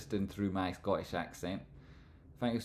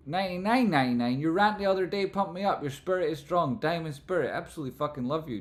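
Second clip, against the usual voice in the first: excited; 8 semitones higher, 6 dB louder, faster than usual. Real, not acted. Reading superchat with hype.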